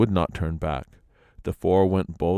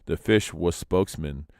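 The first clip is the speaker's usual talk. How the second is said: plain reading voice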